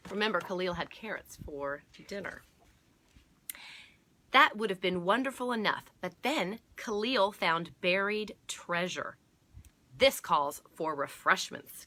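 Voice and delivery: loud, emphatic read-aloud; deliberate picture-book reading, punching key words; story narration. A narrator's reading. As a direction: announce the big discovery to young listeners. Reads the story's big moment.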